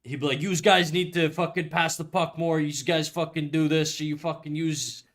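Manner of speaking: put-on hockey coach barking use for you in a French Canadian accent; mock the French Canadian coach yelling at his players. Coach yelling at the team, mock French Canadian accent. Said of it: "he'd talk like that all the time".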